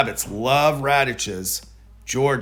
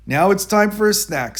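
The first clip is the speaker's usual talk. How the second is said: the man, cheerful; 8 semitones higher, 4 dB louder than usual. A narrator's reading. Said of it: "said the man".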